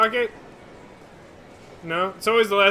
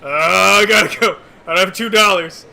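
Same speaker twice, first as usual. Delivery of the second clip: mock flustered excuse; 7 dB louder than usual. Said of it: "Just like".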